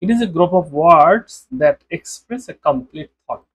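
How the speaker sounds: definition read out very slowly; very slow dictation. Shown as a demonstration. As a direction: dictate it word by word. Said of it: "sentence মানে কি সেটা একটু দেখুন". (খুব ধীরে পড়ে শোনানো)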